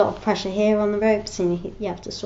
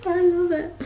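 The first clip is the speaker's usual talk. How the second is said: laughing; 9 semitones higher than usual. Real, not acted. Laughing at the Pooh joke.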